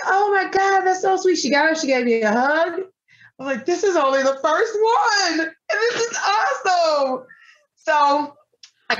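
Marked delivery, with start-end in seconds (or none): 0.1-1.4 delighted gush. Retold or imitated. Vendor's delighted reaction quoted. 3.7-7.3 tearful. Real, not acted. Overcome while recalling giving.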